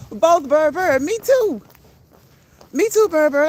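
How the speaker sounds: high, loud playful voice to the bird; pet talk. Real, not acted. Answering her pet bird in a high, loud pet voice. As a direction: chirp it back to the bird.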